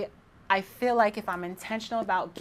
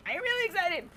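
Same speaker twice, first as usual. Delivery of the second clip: really excited; 11 semitones higher than usual. Real, not acted. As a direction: let the voice leap way up with excitement.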